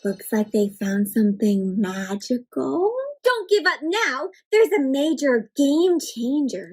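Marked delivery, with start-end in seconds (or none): none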